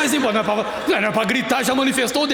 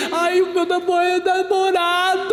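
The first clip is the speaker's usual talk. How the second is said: whiny higher lament, dragging namorado; mocking a churchgoer who only wants a boyfriend; mock pining believer; 5 semitones higher, slower than usual. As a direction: whimper for the boyfriend, hammed-up.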